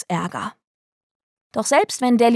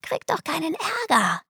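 put-on soft, high child's voice; explaining child; 8 semitones higher, 5 dB quieter than usual. A narrator's reading. Delly explaining her invented words, high innocent reasoning. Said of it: "erklärte sie".

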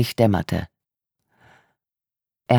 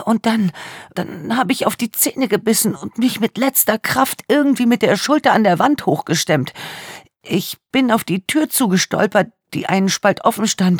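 put-on tearful young woman; 10 semitones higher than usual. A narrator's reading. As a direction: voice a traumatised young woman in tears. Tearful victim speaks.

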